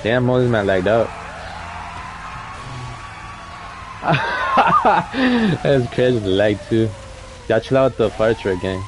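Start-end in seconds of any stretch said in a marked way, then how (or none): 1.1-5.6 big real laugh. Real, not acted. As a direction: crack up at the lag. Laughing at lagged-out player.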